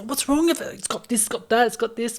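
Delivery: high, mock-whiny kid complaining; kids whining about dinner. Dad imitating his kids whining about the food, high and fussy. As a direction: pitch it up like a fussy child picking at food.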